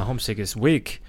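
ordinary talk, nothing marked

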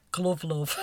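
title said in her own broad northern accent; own regional accent. Shown as a demonstration. Host says the title in her accent. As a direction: lean into the vowels of Glove love, both as in 'luv'. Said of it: "I'm gonna do my accent".

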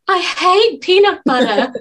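slow, high camp mimicry; mock him with a camp flourish; camp imitation. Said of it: "the way you said".

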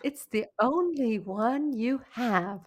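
slow, drawn-out imitation of an Australian ad voice; half-hearted Australian accent, every word stretched; mock Australian ad. Mocking an Australian anti-alcohol video in a lightly put-on Australian accent. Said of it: "a strange accent, but it was in an Australian accent".

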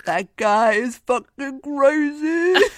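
slow, high, laughing through the words; can't hold the laughter in. Real, not acted. Laughing at the director's antics.

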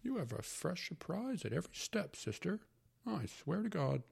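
put-on high, hearty old uncle. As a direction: play the fussy old uncle. Uncle's voice in story.

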